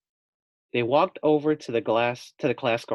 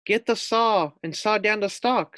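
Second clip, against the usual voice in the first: urgent harp; 8 semitones higher, 3 dB louder than usual. Acted. Harp urges sawing.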